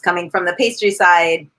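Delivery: ordinary talk, nothing marked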